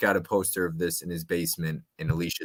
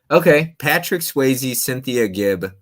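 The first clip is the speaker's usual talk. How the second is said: louder, higher announcing the clue; 6 semitones higher, 8 dB louder than usual. Shown as a demonstration. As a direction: call out the clue like a quiz host. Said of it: "first take of the clue".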